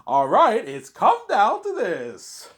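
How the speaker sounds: high, loud, drawn-out showman's delivery; Rabbit impression. YouTuber mimics walkthrough star opening a game, hammed-up impression. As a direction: belt it out slowly like a big dramatic intro. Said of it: "it's not bad impression of him".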